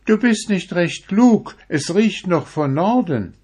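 put-on high, loud old woman warning. A narrator's reading. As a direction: warn him in a high old voice. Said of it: "Die Frau antwortete".

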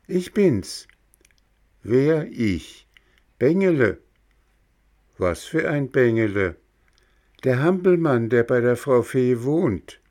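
high, eager puppet voice; a small puppet insisting on who he is; puppet boy voice. Acted. Bengele antwortet der Schnecke. (hohe, eifrige Hampelmannstimme)

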